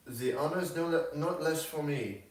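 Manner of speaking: slightly lifted character voice; gracious young Frenchwoman. A narrator's reading.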